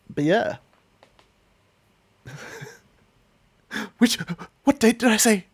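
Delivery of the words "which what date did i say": panicked realization. Retold or imitated. Repeats panicked quote.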